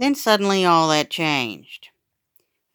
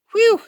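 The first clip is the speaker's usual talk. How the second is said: relieved whew; 8 dB louder than usual. Acted. Narrator relieved.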